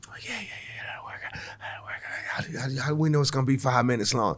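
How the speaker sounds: whispered, hurried self-doubt; nervous muttering. Retold or imitated. Comic acting out last-minute rehearsal with his buddy, nervous whisper. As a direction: mutter it under the breath, nearly voiceless.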